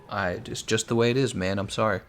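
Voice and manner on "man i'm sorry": ordinary talk, nothing marked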